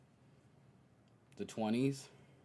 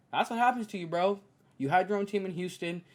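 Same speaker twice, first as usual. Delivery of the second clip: calling him out; 7 semitones higher, 4 dB louder than usual. Real, not acted. Calling out Harden.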